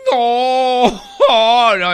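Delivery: high, loud mock wail; drawn-out hammed-up sob; crying farmer. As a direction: cry out a wailing refusal. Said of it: "empieza a llorar".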